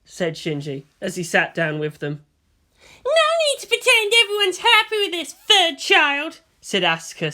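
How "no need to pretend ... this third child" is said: higher, louder sulky grumble; grumbling teen girl. A narrator's reading. Asuka grumbles at Shinji, higher and sulky. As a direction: complain under your breath. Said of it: "still grumbling".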